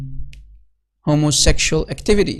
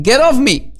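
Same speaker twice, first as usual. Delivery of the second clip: mild mock yell, higher and louder; raised voice, imitating someone alarmed; retold alarmed yell; 7 semitones higher, 5 dB louder than usual. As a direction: retell a startled yell, pitched up. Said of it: "alarmed, yelled".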